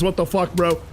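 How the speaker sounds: ordinary talk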